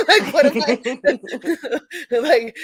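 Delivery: giggling at the memory; laughing. Real, not acted. Laughing recalling wrong race.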